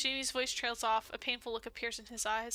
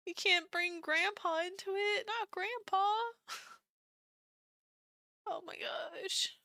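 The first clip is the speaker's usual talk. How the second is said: high tearful protest; weepy, pitched-up voice; crying; 7 semitones higher, slower than usual. Real, not acted. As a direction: plead through real tears, fading to a whisper. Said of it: "I'm crying so much".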